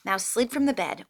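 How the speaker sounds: usual speaking voice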